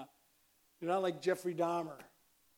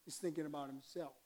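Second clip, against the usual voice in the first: normal talking voice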